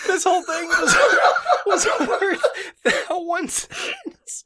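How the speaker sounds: high, loud laughter through the words; cracking up mid-sentence; laughing hard. Real, not acted. Cracking up at the story.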